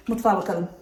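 ordinary talk

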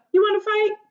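high, pushy taunting challenge; challenging. A narrator's reading. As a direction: taunt them into a fight. Grouchy ladybug challenges.

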